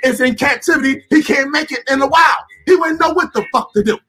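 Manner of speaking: high-pitched, forceful scorn; impassioned rant. Real, not acted. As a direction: keep the fired-up tirade going. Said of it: "when a brother speak like this, he angry".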